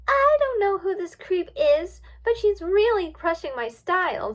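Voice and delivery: flamboyant diva, high, loud disdain; Rainbow Dash. A narrator's reading. Rainbow Dash complaining about Twilight, flamboyant disdain. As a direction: dismiss her with drawn-out theatrical scorn. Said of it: "in a very flamboyant voice".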